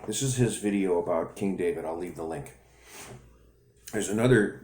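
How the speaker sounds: usual speaking voice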